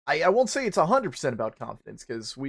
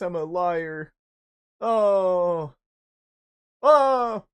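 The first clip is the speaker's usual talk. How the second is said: hammed-up high woe-is-me wail, slow; mock wailing self; 8 semitones higher, 9 dB louder, much slower than usual. Mocking himself to his girlfriend with a high theatrical wail. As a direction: whine it out like a tragic hero. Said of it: "And I'm like".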